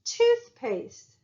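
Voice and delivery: "Toothpaste" with the first syllable pitched high; stress on first syllable. Shown as a demonstration. Teacher giving examples of stressed syllables, "Toothpaste" stressed high up front. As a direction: punch the "Tooth" in "Toothpaste", drop off after. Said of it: "more stress on the dough and less".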